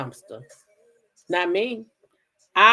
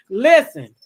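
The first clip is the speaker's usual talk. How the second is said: normal talking voice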